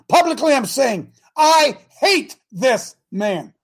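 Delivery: emphatic declaration. Real, not acted. Preacher publicly declaring his hatred to congregation, emphatic. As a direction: proclaim it word by word, slowly and loudly.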